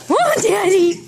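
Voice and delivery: loud, happy cry; joyful cry. Real, not acted. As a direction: burst out with joy. Excited greeting.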